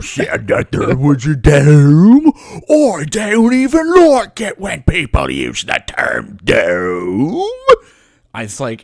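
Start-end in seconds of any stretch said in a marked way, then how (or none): 0.0-2.3 loud, slow, mocking exaggerated voice; Nathan Oakley impression. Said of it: "he talks like this the whole time". 2.7-7.4 loud, slow, mocking exaggerated voice; Nathan Oakley impression. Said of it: "he talks like this the whole time".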